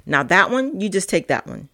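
normal talking voice

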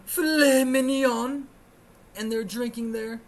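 loud, high, drawn-out sneer at luxury; hammed-up fancy menu item; mocking fancy food. Mocking the rich diners' menu.

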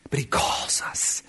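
slow hushed whisper. Real, not acted. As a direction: breathe it to the congregation. Sermon drops to hushed point.

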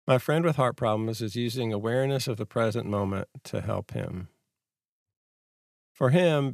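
ordinary talk, nothing marked

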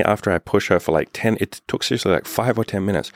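usual speaking voice

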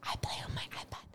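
very soft, hushed whisper. Shown as a demonstration. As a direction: repeat it as a faint ASMR whisper. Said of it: "you gotta whisper it".

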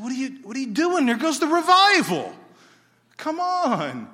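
put-on high, loud, fast cry after the kids; exasperated shout. Preacher watching his revival run off the bus, loud frustrated yell.

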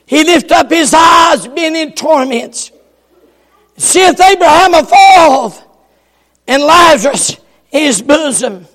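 usual speaking voice

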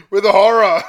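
very loud, high, laughing delivery; hammed-up horror. Reader mocks the narration tag for a friend, hammed-up horror. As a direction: belt it out through laughter.

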